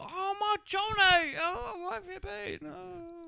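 very high, drawn-out hammy wail; slow falsetto mock cry. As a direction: wail it like an overacting soap heroine, fading away. Mimicking Denise wailing from the window.

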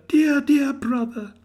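put-on high, slow, loving child; a small sister greeting her brother; little girl voice. A narrator's reading. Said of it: "addressed him as her".

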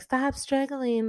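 high, slow mocking command; lightly voiced taunting villain. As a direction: sneer it down at her like the killer. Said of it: "bitch is like".

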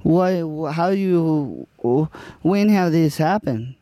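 light put-on Spanish accent. Improv scene as Juan.

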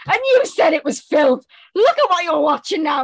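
triumphant gotcha. Retold or imitated. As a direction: loudly catch her out, gleeful. Catching sister watching the film.

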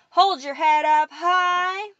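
slow, loud, high chant; called out. A narrator's reading. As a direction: lead the chant across the hall. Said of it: "Mora called".

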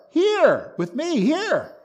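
both "here"s pitched very high, then falling; stress on HERE. Shown as a demonstration. Preacher showing God was there with him, emphatic stress on HERE. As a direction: punch each "here" as its own emphatic point. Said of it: "Not there".

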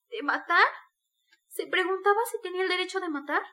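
appalled, high-pitched disbelieving question; desperate exclamation. A narrator's reading. Sonia aghast at his claimed right to kill, desperate. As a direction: cry it out in desperate shock. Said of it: "Exclamó Sonia uniendo las manos con un gesto desesperado".

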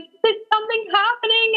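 imitating mom's high, giddy question; excited mom. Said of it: "she was like a kid on Christmas".

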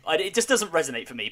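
usual speaking voice

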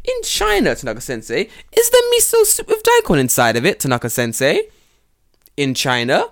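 high, loud sing-song needling question. Retold or imitated. Teacher torments Tanaka sensei with an absurd question. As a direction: pile on the sweetness to annoy. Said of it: "I'm like".

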